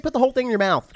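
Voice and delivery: for God's sake, just tell them; exasperated order. Real, not acted. Exasperated at cautious taster.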